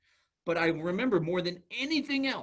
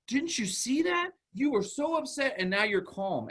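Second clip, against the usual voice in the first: put-on high, wondering talk to a child; amazed praise; 9 semitones higher than usual. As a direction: marvel at the child's achievement.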